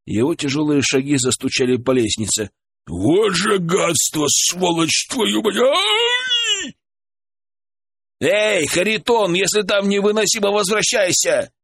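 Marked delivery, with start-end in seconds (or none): 2.9-6.8 high, slow cursing yells ending in a cry; storming off shouting. Acted. Blacksmith scalded, cursing at door. 8.2-11.5 calling out. Acted. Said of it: "крикнул Александр".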